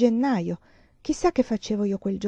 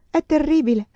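higher and louder, voice cracking in despair; broken voice; 7 semitones higher, 7 dB louder than usual. A narrator's reading. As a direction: let the repeat break into anguish. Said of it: "Parlava con voce spezzata". (voce spezzata, più alta e forte, disperata)

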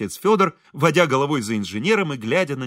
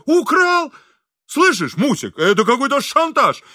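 very high, loud indignant shriek; boiling over; 11 semitones higher, 6 dB louder than usual. A narrator's reading. Furious engineer appealing to his wife, boiling over. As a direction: cry out in outrage. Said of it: "вскипел инженер".

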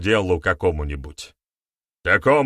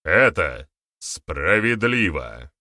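slow, each word pressed; with emphasis; 3 dB louder, much slower than usual. A narrator's reading. Baryata answering the elder, pressed slow emphasis. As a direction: lean hard on every word. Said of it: "с нажимом произнёс Барята".

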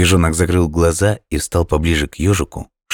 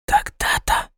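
breathy sleepy whisper; drowsy whisper; 4 dB quieter, slower than usual. A narrator's reading. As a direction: whisper it half asleep. Drowsy hedgehog repeating himself.

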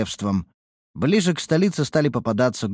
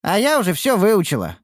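higher, proud little boast; 11 semitones higher, 4 dB louder than usual. Acted. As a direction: show off proudly. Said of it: "похвасталась Ниуру".

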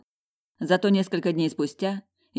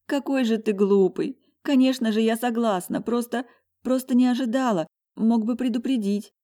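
trembling tearful voice; 7 semitones higher than usual. A narrator's reading. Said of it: "мои дрожащие губы".

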